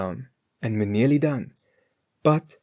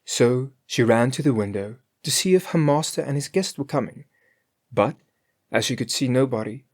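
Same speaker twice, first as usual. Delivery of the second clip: ordinary delivery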